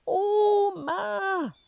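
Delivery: put-on a wailing child's scream; high crying shout. Boy screams for grandma, crying and high. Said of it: "schreit er nochmal in den höchsten Tönen".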